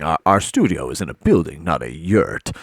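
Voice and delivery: ordinary talk, nothing marked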